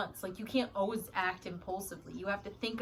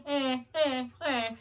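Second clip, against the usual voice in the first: higher, louder buzzer sound; alarm buzzer noise; 5 semitones higher, 6 dB louder, slower than usual. Pretend. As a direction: buzz like a warning alarm. Mimicking a warning alarm.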